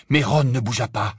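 plain reading voice

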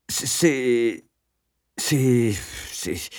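halting repeated "c'est", trailing off; stammering. A narrator's reading. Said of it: "bégayai-je". (bégaiement hésitant)

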